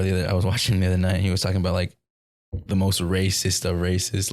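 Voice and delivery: ordinary talk, nothing marked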